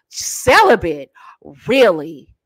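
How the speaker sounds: slow, drawn-out disbelief; skeptical man. Acted. Man hears she is celibate, slowly skeptical. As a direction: question it slowly, as if sizing her up.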